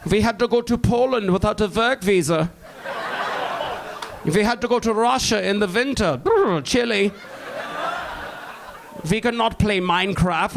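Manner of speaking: lightly voiced old German veteran; old German accent. Old Nazi veterans reminiscing.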